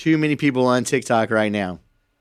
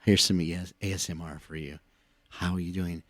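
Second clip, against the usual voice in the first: soft, hushed ASMR murmur, much quieter; mock ASMR voice; 6 semitones lower, 11 dB quieter than usual. Mocking ASMR creators greeting viewers, in a soft hushed coo. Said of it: "And they're all talking like this".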